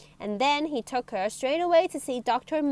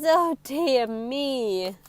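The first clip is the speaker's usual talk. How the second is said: ordinary delivery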